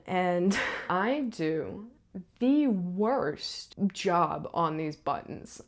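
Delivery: ordinary delivery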